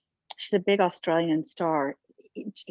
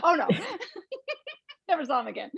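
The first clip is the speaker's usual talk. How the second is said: laughing; 10 semitones higher, much faster than usual. Real, not acted. Laughs while telling.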